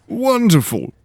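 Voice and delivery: high, loud delighted exclamation; delighted lord exclaiming; gleeful. Acted. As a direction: burst with glee. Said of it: "Lord Henley said gleefully".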